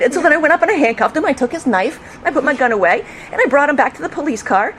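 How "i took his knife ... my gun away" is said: usual speaking voice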